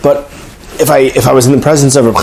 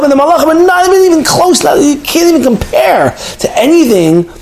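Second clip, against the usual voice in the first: rising awe; 8 semitones higher than usual. Real, not acted. Climactic awe about greatness.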